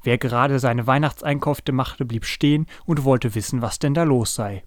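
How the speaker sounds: usual speaking voice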